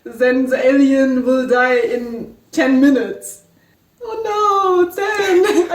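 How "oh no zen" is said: high, plaintive drawn-out wail; mock-grieving whine; playful lament. Real, not acted. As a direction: mourn the character half in jest. Lamenting the dying character.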